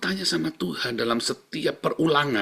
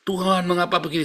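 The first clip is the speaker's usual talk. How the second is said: high, protesting cry to God; complaining prayer; 7 semitones higher than usual. Shown as a demonstration. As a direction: question God in a raised, aggrieved pitch. (doa mengeluh bernada tinggi)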